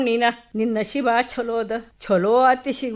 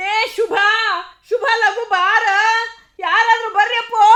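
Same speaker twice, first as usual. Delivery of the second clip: panicked calls; 7 semitones higher than usual. Acted. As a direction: scream for anyone to come. Calling for help.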